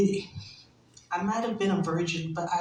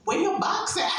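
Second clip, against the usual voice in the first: incredulous; 9 semitones higher than usual. Retold or imitated. Outraged quip, high pitch.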